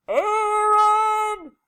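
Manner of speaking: long, high call to someone far away; loud sing-out yell from mother. A narrator's reading. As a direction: holler the name, stretched right out. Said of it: "she yelled".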